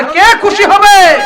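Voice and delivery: high, loud rousing question. Real, not acted. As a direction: call it out so the whole crowd answers. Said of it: "আরো জোরে". (আরো জোরে, উঁচু গলায় প্রশ্ন)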